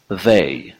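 they with buzzed th, tongue on teeth; voiced th model. Shown as a demonstration. Teacher giving an example, they with English voiced th.

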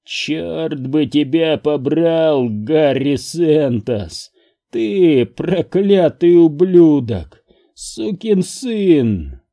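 high, slow, furious curse; drawn-out enraged denunciation; cursing through clenched teeth. A narrator's reading. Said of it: "Мои зубы сами собой сжались".

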